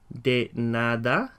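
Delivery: "De nada" very slow with a rise; teacher drilling slowly; slow model. Shown as a demonstration. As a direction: linger over "De nada". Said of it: "as we say the words in Spanish".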